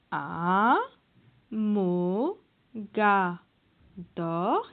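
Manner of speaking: "A, mo, ga, dor" as four separate syllables with pauses; split into syllables. Shown as a demonstration. Teacher to children, sounding out "A, mo, ga, dor" syllable by syllable. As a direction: sound out "A, mo, ga, dor" one beat at a time. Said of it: "Il y a le D ici".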